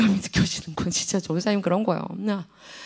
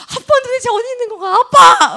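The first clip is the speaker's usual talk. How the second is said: put-on high, wailing kid voice calling out; whiny child calling; 12 semitones higher, 7 dB louder than usual. Preacher voices his kids crying for daddy, high and whiny. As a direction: whine like a lost child.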